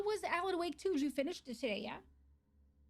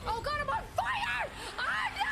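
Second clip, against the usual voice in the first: higher, rising panic as the fire hits; panicked cry; 11 semitones higher than usual. Real, not acted. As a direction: yelp in real alarm. Set on fire in game.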